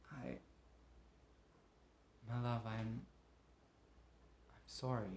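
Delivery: soft, broken-off apology; husband begging his wife to come home; remorseful pleading. Acted. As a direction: beg forgiveness, ashamed and quiet.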